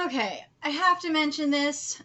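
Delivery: usual speaking voice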